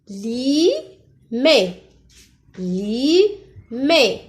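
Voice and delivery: "Li, mais" in two beats, long li, stressed mé; syllable by syllable. Shown as a demonstration. Teacher checking a lookalike word, "Li, mais" split apart. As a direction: separate "Li" from "mais", ending on an é sound. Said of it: "je vois ici le son E".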